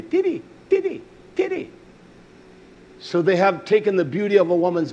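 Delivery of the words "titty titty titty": "Titty" repeated high and quick, like a foreign word; "titty" in separate, high-pitched repeats; Kiswahili pronunciation. Retold or imitated. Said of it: "That's how they say it in Kiswahili".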